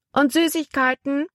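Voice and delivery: put-on high-pitched small child's question; little boy. A narrator's reading. Little boy on shore asking about treats, high child's call. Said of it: "wollte ein kleiner Junge wissen".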